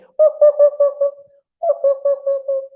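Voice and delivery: very high, loud monkey ooh-oohs; baby monkey hoots. Acted. Baby monkeys who can only say ooh, high hooting. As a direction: hoot like an excited little monkey. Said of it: "Baby monkeys, of course, only can say".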